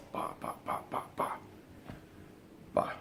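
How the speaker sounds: soft whispered bah for each shot; gunshot noises. Retold or imitated. My father shooting the shark in the head, whispered gunshot sounds. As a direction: tap out the shots softly, lips only.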